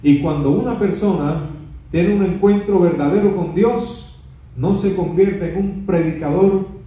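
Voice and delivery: ordinary delivery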